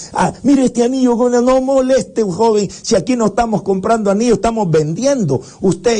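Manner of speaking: high, dismissive vendor voice; annoyed market vendor. Acted. Market vendor tells the young man to go away, high and dismissive.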